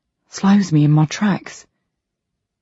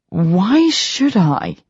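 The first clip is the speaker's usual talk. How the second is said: counters; 4 semitones higher, much slower than usual. A narrator's reading. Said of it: "Rage counters".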